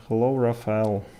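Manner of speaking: ordinary talk, nothing marked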